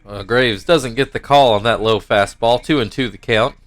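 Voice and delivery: normal talking voice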